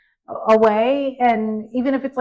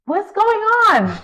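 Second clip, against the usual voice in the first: alarmed concern; 11 semitones higher, 6 dB louder than usual. Retold or imitated. Mother sees son tearing up.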